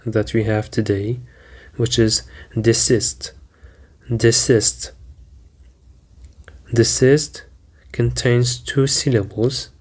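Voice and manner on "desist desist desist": desist said de-SIST, weight on SIST; stress on second syllable. Shown as a demonstration. Teacher introducing the last word, de-SIST. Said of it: "the stress is on the second syllable".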